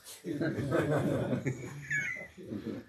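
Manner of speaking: long high-pitched laugh. Real, not acted. Teller amused by Baruch's reaction to Zushi being here, laughing.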